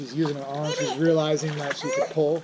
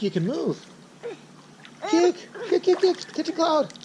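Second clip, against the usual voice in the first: put-on much higher sing-song cheering for a baby; baby-talk cheering; 9 semitones higher, 3 dB louder than usual. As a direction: cheer the little swimmer on, bright and bouncy. Dad cheering baby in pool.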